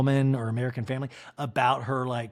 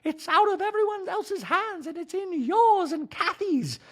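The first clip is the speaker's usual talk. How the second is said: a doting wife, pitched way up; Dorit impression. Wife nagging husband about salad, high mock voice. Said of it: "And Dorit's like".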